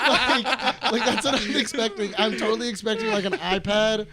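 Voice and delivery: high, loud, talking through laughter; real laughter breaking into the words; laughing. Real, not acted. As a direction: crack up while explaining. Cracking up at own joke.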